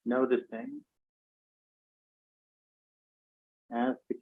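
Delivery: calm, even meditation voice with long pauses; guided meditation. Real, not acted. Teacher guiding an online class to count breaths, calm and spacious. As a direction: lead breath counting gently, leaving space after each phrase.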